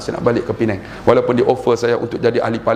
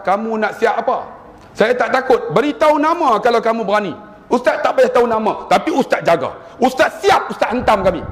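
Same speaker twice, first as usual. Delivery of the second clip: much higher, rising defiance; defiant retort; 9 semitones higher than usual. Retold or imitated. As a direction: throw it back at the threatening caller. Retold angry phone exchange. (membalas dengan nada tinggi mencabar)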